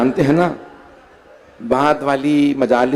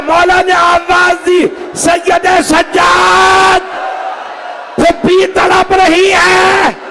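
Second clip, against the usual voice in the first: preaching at full force; 11 semitones higher than usual. Acted. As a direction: drive the mourning story to a full-throated climax. Majlis climax, narrator cries out.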